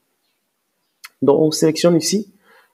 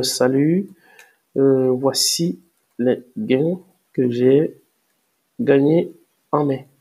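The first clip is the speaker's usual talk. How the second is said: drawn-out words with long gaps; composing aloud while typing, slowly; slow dictation; much slower than usual. Real, not acted. Dicte le message en le tapant. (dictée lente en tapant)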